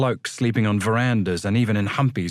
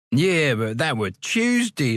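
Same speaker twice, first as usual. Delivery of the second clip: character voice; 4 semitones higher, slower than usual. Acted. Inmate voiced, dialect grammar.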